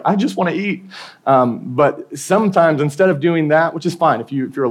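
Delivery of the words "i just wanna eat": hungry whine. Retold or imitated. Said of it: "And you're like".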